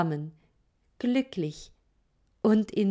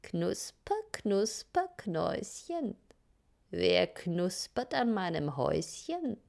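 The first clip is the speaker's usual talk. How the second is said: scratchy witch voice; 7 dB quieter than usual. A narrator's reading. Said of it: "Plötzlich hörten sie eine kratzige Stimme".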